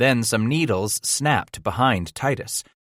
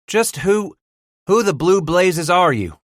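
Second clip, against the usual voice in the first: startled demand; 10 semitones higher, 6 dB louder than usual. A narrator's reading. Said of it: "Bass inquired".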